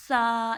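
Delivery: سا with a lisped, airy tongue-tip sound; demonstrated tha. Shown as a demonstration. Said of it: "کیسے ادا ہوگا؟".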